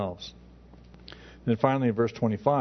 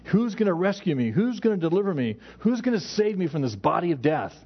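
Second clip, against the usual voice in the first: desperate plea; 6 semitones higher than usual. Retold or imitated. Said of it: "that desperate plea".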